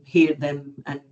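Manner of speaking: ordinary delivery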